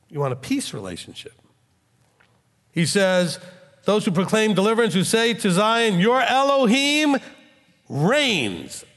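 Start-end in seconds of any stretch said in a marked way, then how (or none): 3.8-8.8 preaching scripture. Acted. Proclaims verse building to Elohim.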